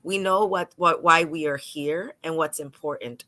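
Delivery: ordinary delivery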